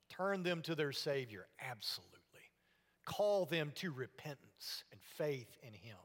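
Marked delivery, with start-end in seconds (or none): none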